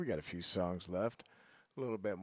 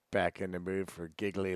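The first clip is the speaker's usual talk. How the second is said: usual speaking voice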